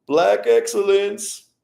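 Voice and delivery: sarcastic impression of a fan cheering; mocking quote. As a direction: ham up the fake admiration. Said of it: "I guarantee you're gonna be like".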